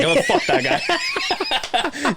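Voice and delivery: high, drawn-out retort through laughter; laughing mock retort. Absent friend reacting to the shout-out, laughing mock retort. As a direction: imitate an offended friend while cracking up.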